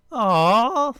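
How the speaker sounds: high, loud adoring aw; big swooping coo; gushing. A narrator's reading. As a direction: gush like a charmed crowd. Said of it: "the crowd gushed".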